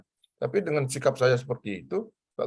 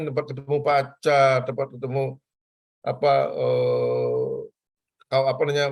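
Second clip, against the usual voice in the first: ordinary talk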